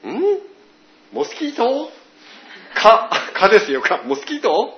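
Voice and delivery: mock confused foreigner asking back; American mishearing. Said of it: "って言って". (聞き間違えるアメリカ人の、高く戸惑った声)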